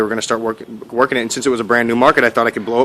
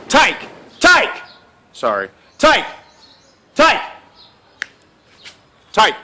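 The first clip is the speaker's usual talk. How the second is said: calling the dog; 11 semitones higher, much slower than usual. Real, not acted. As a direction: call the dog sharply.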